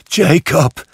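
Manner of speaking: high, loud anguished call of a name; pleading. A narrator's reading. Scrooge pleads with Marley's ghost, high and desperate. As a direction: plead with your dead partner. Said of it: "sagte er flehend".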